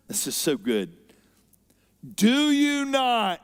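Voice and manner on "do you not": "Do you not" pitched high, louder and slow; pleading stress. Shown as a demonstration. Disciples to Jesus, stretched out high and loud. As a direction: lean hard on "Do you not". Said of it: "circle this next word".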